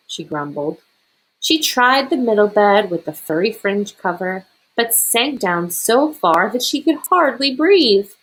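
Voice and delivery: plain reading voice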